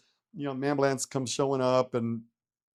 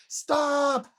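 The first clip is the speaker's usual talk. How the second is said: much higher, drawn-out "stop"; retold cry to stop; 10 semitones higher than usual. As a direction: call a halt in a high, stretched voice. Begging gurney pushers to stop.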